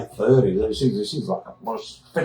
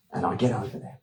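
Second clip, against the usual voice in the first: usual speaking voice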